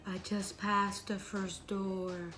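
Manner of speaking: slow ghostly call, loud on the name; creepy voice. Acted. Creepy voice nearing the girl's door, slow and looming. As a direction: stretch it out, swelling on the name then easing. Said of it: "And the voice gets louder".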